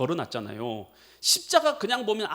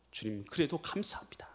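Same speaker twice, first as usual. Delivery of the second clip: breathy hushed thanks to the Lord; whispered thanks; 4 semitones lower, 8 dB quieter than usual. Retold or imitated. Believer thanking the Lord, soft whisper. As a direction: whisper your gratitude.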